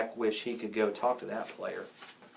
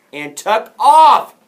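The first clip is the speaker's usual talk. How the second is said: loud, slow, rising to a high shout; dramatic outburst; 10 semitones higher, 19 dB louder, much slower than usual. A narrator's reading. Narrator ends as the boy flees, shouting. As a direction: shout the climax of the tale.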